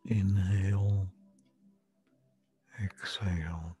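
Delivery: whispering. Acted. Breathing cue in meditation.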